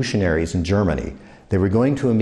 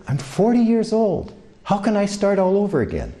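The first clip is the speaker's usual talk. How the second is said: quoted refugee; 10 semitones higher than usual. Retold or imitated. Refugee asking the speaker how to start over, mildly pleading.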